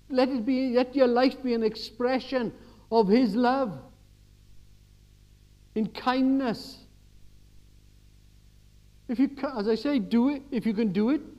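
high, loud impassioned exhortation; full-force preaching. Acted. Preacher urges the church to kindness, loud and high.

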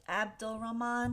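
ordinary talk

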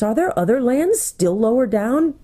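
high, amazed question; exclaiming. A narrator's reading. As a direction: ask it high, in wonder. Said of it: "exclaimed Eustace".

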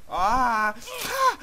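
loud yelp leaping very high; pained scream. Real, not acted. Crashed rider crying out, shrill pained scream.

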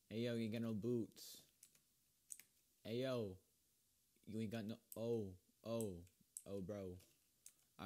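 ordinary talk

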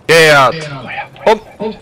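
barked drill commands. Acted. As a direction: snap the order out.

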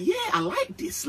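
very high, pleased squeak; mock delighted squeal. Fan reliving the forwards' celebration, high delighted squeak. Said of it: "I'm like".

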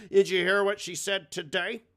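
high, loud, sneering mimicry of her; mocking impression. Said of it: "to be like".

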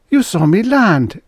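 high, loud eager cry. Acted. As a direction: burst out with hope. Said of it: "cried Jessup eagerly".

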